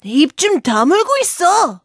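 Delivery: high, fast Gryphon scolding; snapping rebuke. Acted. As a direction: shut her up sharply in a creature voice. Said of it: "핀잔을 주었다".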